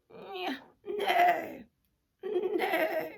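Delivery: high whinnying horse; imitation of a horse; horse neigh. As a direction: neigh the word out. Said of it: "Nice horse".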